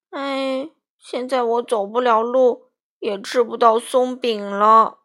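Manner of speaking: hurt bear whining to his friends; groaning in pain. A narrator's reading. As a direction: moan about it like it really hurts. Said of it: "艾迪熊疼得直哼哼".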